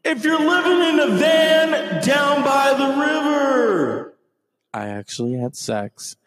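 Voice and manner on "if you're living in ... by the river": loud, high sung-shout Farley rant; hammed-up Chris Farley yell; Chris Farley impression. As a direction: bellow it like Farley's motivational speaker. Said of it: "Remember Chris Farley?".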